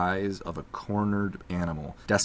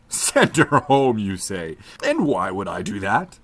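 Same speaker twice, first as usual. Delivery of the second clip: scornful magician; 2 semitones higher, 7 dB louder than usual. A narrator's reading. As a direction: scoff at the idea of sending her home. Magician mocks the request.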